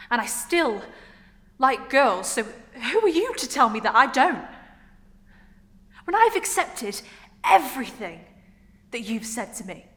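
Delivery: acted outburst of hurt anger; angry confrontation. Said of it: "remembers how angry she is at what Adrian said".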